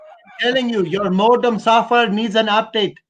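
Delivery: put-on Indian tech support accent; insistent mock Indian call-center agent. Shown as a demonstration. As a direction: plead with a customer in an Indian accent. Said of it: "use that voice".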